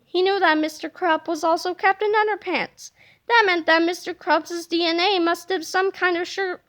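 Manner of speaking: ordinary delivery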